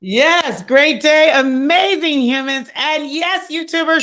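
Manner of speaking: high, bright burst of welcome; loud, high-energy hello; excited greeting. Real, not acted. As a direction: greet the audience with a big lift.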